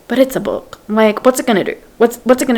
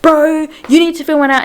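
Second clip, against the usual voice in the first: heated retort; 8 semitones higher, 4 dB louder than usual. Retold or imitated. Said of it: "they're, like, really heated".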